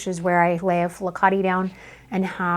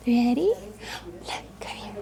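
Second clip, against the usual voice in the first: ordinary delivery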